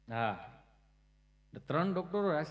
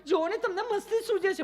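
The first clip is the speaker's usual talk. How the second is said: high laughing retort; 14 semitones higher, 4 dB louder than usual. Retold or imitated. Mother scolds, laughing high.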